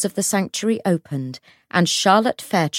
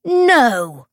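loud, high wail of protest; 7 semitones higher, 7 dB louder than usual. A narrator's reading. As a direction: cry out "no" as if stabbed. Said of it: "she wailed".

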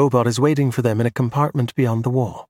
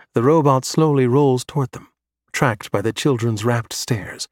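usual speaking voice

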